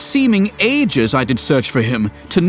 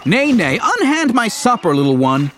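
protesting; 10 semitones higher than usual. Acted. Luke protests supper grab.

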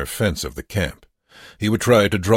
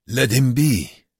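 put-on loud, higher accented command; firm order; 7 semitones higher, 6 dB louder than usual. A narrator's reading. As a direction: call them off him firmly. Wernicke orders others off.

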